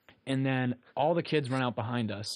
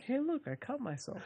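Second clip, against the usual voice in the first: casual quote; 12 semitones higher, 6 dB quieter than usual. Retold or imitated. Showing mom the cut.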